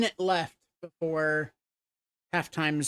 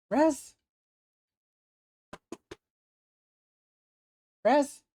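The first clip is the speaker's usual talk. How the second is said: high call to a dog; 9 semitones higher, 4 dB louder than usual. Real, not acted. Calling dog by name.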